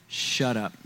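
dropped low and soft, a stunned hush; stunned shut up. Retold or imitated. Fiancée answering his proposal, stunned and softly hushed.

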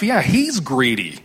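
normal talking voice

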